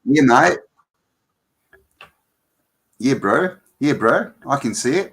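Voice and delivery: broad New Zealand accent with lifted endings. Shown as a demonstration. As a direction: put on a matey Kiwi accent. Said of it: "can you do New Zealand?".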